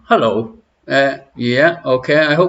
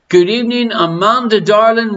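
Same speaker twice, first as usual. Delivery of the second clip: sing-song greeting; 6 semitones higher than usual. Acted.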